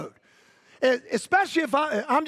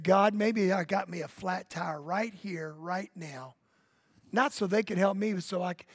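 quiet inner prayer; 4 semitones lower, 6 dB quieter than usual. Retold or imitated. Pastor's inward prayer retold.

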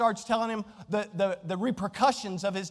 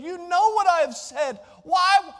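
loud, higher outburst of frustrated love; God shouting at Cain in frustration; exasperated shout; 9 semitones higher, 6 dB louder, slower than usual. Acted. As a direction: raise it to a cry. God's plea rises to shout.

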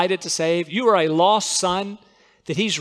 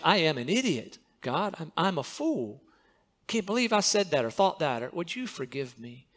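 much softer contrite confession; humble prayer; 2 semitones lower, 9 dB quieter than usual. Shown as a demonstration. Preacher shows his own prayer when in sin, hushed and contrite. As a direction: hush down into honest confession. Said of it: "those are the words I'll use".

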